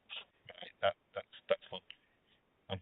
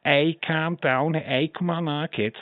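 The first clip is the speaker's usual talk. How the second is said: Liverpool lilt, slightly raised pitch; Scouse accent; 3 semitones higher, 6 dB quieter, slower than usual. Shown as a demonstration. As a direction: demonstrate Scouse calming people down. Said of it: "Scouts are high pitched".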